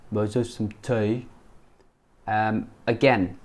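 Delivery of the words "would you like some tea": "tea" as "tay" in a mimicked regional UK accent; imitated regional accent. Teacher mimicking another UK accent, regional "tay" for "tea". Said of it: "This guy's saying tea".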